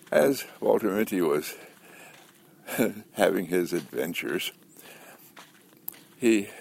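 ordinary talk

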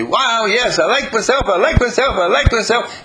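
high, loud, gleeful cheering with laughter; giddy high-pitched delight; jumping for joy. Pretend. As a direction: squeal it like tiny cells jumping for joy. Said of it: "stem cells are jumping up for joy and say".

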